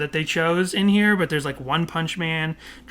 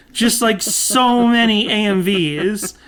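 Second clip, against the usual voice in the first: loud, higher, drawn-out emphasis on SO MANY; too loud; 4 semitones higher, 4 dB louder, slower than usual. Real, not acted. Host exclaims over so many A M Vs, too loud. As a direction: exclaim it too loudly, stretching it out. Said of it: "says I'm being too loud".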